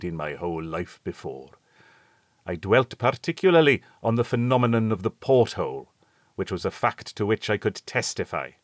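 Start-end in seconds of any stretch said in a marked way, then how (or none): none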